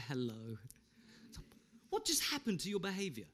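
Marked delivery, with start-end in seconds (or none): 0.0-0.6 very soft, low, sweet hello; gentle put-on phone greeting; beautiful summer's day. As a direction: answer the phone all sunshine and calm. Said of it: "this beautiful summer's day".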